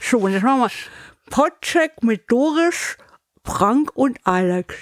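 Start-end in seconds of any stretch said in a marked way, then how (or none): 1.3-4.7 slow, over-articulated round a cork; cork in teeth. Shown as a demonstration. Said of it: "Korken in den Mund".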